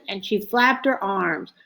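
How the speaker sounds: plain reading voice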